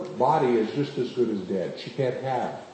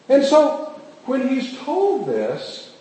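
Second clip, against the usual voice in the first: normal talking voice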